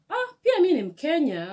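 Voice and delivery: high, loud happy exclamation; a fellow Kenyan's glad surprise; retold cheerful hello; delighted greeting. Kenyan man greets her delightedly.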